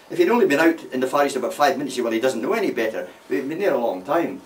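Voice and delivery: usual speaking voice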